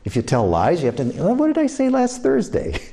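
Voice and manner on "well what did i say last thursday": higher, lightly mock flustered liar. Liar trying to recall an old story, mock flustered. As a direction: fake a liar scrambling to remember. Mimicking liar's panic.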